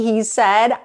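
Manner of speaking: ordinary delivery, nothing marked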